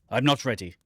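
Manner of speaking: ordinary talk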